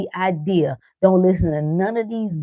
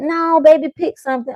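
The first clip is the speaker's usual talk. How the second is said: higher, coaxing mom; parent urging; 9 semitones higher, 4 dB louder than usual. Retold or imitated. Host mom urging the shy girl to pick, higher. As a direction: coax the child warmly. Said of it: "parent urging".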